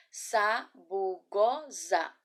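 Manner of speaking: Sabugosa very slow, each syllable held apart. Shown as a demonstration. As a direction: demonstrate Sabugosa in four isolated syllables. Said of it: "vamos lá novamente, olha só".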